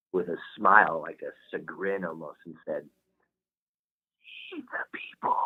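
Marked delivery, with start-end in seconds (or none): 4.5-5.4 whispered. Retold or imitated. Dying grandpa giving his grandson the secret, whispered. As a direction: imitate a frail old man's urgent whisper. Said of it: "He whispered".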